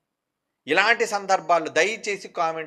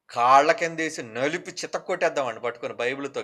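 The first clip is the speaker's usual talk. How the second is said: usual speaking voice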